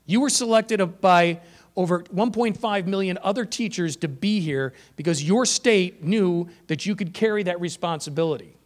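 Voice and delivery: usual speaking voice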